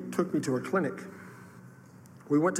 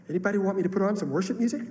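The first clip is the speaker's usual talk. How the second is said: higher, quick, sweet little offer; 5 semitones higher, much faster than usual. Retold or imitated. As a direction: imitate a sweetly helpful pastor's wife. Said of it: "in a very sweet voice".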